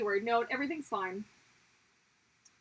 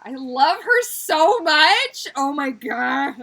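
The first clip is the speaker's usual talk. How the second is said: much higher, much louder, slow gush; 4 semitones higher, 16 dB louder, much slower than usual. Real, not acted. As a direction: squeal with love for her. Gushing about Merida.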